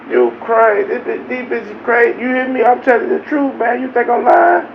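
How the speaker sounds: ordinary talk, nothing marked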